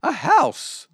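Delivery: high-pitched donkey call; shouting donkey. A narrator's reading. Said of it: "the donkey shouted".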